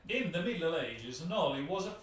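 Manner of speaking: put-on thick Italian accent. Host attempting the intro text in his thickest Italian accent. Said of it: "the accent, the accent".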